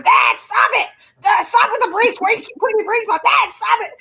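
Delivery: high-pitched mock yell of a fed-up daughter; imitating daughter yelling. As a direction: nag dad about the brakes, pitched high. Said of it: "She's going".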